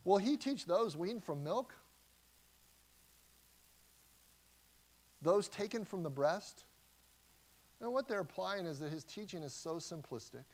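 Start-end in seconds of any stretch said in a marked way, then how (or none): 0.0-6.6 mocking. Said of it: "They ask".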